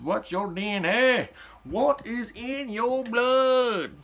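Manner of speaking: high, slow, booming question; drawn-out dramatic ask. Pretend. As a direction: ask it like a game-show host. Dramatic DNA question to viewers.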